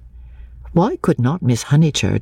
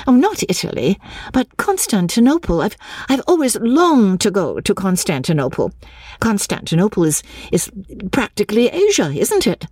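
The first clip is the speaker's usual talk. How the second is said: enthusiastic; 6 semitones higher than usual. A narrator's reading. Heroine enthusing about travel.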